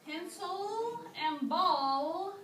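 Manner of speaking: "Pencil and ball" slowly, holding each final L; final L emphasized. Shown as a demonstration. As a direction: stretch the L endings in "Pencil and ball". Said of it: "both have a l sound".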